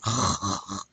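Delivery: throaty French R rasp; drawn-out gargled "rrr"; wet R gargle. Shown as a demonstration. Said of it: "I really wanna hear that".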